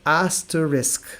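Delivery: asterisk, clear model pronunciation; slow model word. Shown as a demonstration. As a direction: say asterisk carefully, each syllable clear. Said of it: "the way to pronounce it is".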